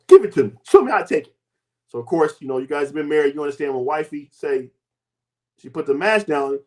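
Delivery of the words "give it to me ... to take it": much louder, insistent demand; wife grabbing the product, loud and insistent. Retold or imitated. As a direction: grab it and insist. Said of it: "She stole it".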